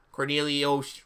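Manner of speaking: ordinary delivery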